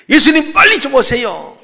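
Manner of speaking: high, loud cry for help; urgent plea. Acted. Sisters send for Jesus as their brother is dying, urgent plea.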